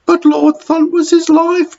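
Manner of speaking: very high, pleading dinner lady; protesting dinner lady. Acted. Dinner lady protesting to Matron, high and pleading. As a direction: plead for the poor porter. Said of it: "protested Tootsie".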